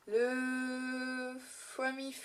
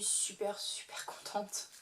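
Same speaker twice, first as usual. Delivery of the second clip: soft breathy whisper, slowed at the end; a whispered, contented aside; whispered delight; 8 dB quieter than usual. Real, not acted. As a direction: whisper it like a happy secret.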